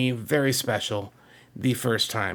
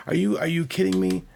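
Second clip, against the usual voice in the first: higher-pitched incredulous retort; can't-believe-it question, raised voice; disbelief; 8 semitones higher than usual. Retold or imitated. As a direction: retell your stunned disbelief, pitched up. Retells his disbelief at friend.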